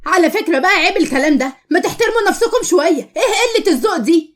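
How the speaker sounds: high-pitched, outraged scolding; indignant rebuke. Acted. May rebukes classmates for mocking her friend, indignant. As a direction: tell them off, pitch rising.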